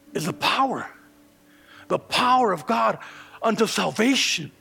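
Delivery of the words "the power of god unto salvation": louder, rising preacher's cry; fervent preaching. Acted. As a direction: preach it hard to the congregation. Preaching at full force.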